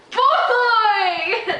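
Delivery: high, loud incredulous cry; exclaiming. Real, not acted. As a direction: yelp it out in disbelief. Reacting to absurd claim.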